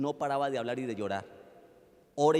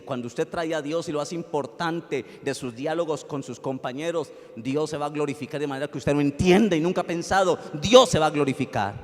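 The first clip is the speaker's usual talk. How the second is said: louder, building to a ringing climax; preaching at full force; 3 semitones higher, 9 dB louder than usual. Acted. Pastor exhorting the congregation to bring God into conversations, full-force preaching. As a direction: drive it home to the congregation.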